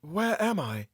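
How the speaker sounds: quiet bewildered question; calm. A narrator's reading. Captive woman waking, calmly asking where she is. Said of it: "she said in a calm voice".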